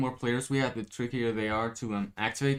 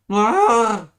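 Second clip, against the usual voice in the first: sudden high scream; loud frightened cry; alarmed scream; 11 semitones higher, 15 dB louder than usual. Acted. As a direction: shriek in fright.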